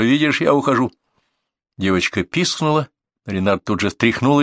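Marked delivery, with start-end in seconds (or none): 0.0-0.9 raised-pitch call. A narrator's reading. Narrator backs away from hostage-taker, calling out. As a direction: show him you're backing off. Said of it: "крикнул я модельеру".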